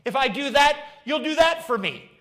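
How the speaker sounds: high, loud, fast mock believer bargaining with God. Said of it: "worship God as if he is Rover".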